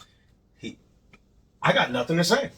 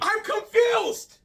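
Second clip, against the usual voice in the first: incredulous; 12 semitones higher, slower than usual. Real, not acted. Stunned reaction.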